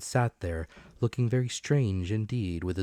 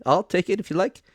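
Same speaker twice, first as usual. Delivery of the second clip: higher and louder, a bustling, eager supervisor; excitable officer voice; 12 semitones higher, 10 dB louder than usual. A narrator's reading. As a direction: play the keen revenue officer, pitched up. Mister Dance voiced in story.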